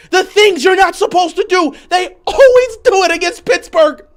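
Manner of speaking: high, loud fan tirade; exasperated yelling. Real, not acted. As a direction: yell it in sheer frustration. Rant at the team.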